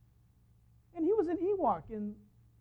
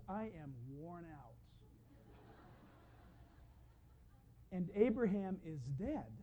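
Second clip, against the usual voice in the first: put-on weary old woman; 7 semitones lower, 14 dB quieter than usual. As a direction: sigh the years out of each word. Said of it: "She says".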